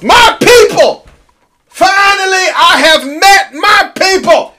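high, slow, shouted mock celebration; overjoyed shout. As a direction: cry out like you finally found your tribe. Mock joy at controversial crowd.

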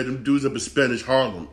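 normal talking voice

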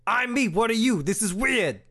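baffled merchant snapping back at the customer; Borkat voice. Acted. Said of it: "my Borkat voice".

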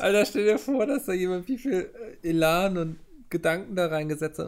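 laut und hoch vor Staunen; aufgeregt ungläubig. Real, not acted. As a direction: fassungslos begeistert ausrufen. Ungläubig über den Fund. (laut, fassungslos, erstaunt)

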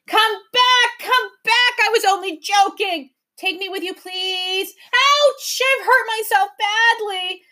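very high, loud wailing creatures; howling pleas. A narrator's reading. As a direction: wail and beg after them. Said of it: "they sobbed and howled".

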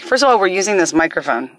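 ordinary delivery

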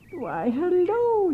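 high, slow sugary coo. Acted. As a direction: coo like a fake sweet old lady. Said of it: "she cooed".

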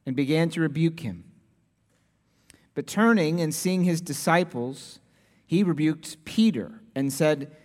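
measured Bible reading from the pulpit; solemn scripture reading. Acted. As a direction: read the passage with weight. Reads the scripture passage aloud.

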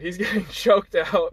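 laughing through the words; can't keep a straight face telling it; giggling as the buddy gets choked out. Real, not acted. Laughing at buddy losing fight.

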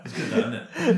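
cracking up mid-sentence at the banter; laughing. Real, not acted. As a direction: laugh helplessly as you speak.

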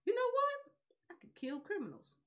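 lightly voiced villain epiphany; a hint of a smug would-be god; mock Light Yagami. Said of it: "'cause he was like".

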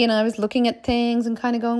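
normal talking voice

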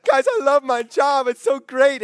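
high, loud mock gushing; bragging. Friend showing off a new job to someone miserable, bragging. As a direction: brag in an exaggerated excited voice. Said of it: "you're bragging about".

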